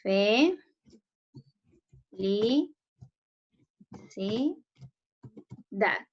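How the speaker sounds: Fe, li, si, da as four isolated syllables, long pauses between. Shown as a demonstration. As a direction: dictate each syllable alone, Fe, li, si rising, da falling. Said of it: "separen felicidad".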